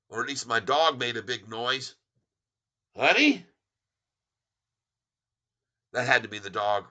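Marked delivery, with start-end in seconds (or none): 3.0-3.4 raised, high questioning call. Real, not acted. Checking on wife after a big noise, calling out. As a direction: call out to someone in the house.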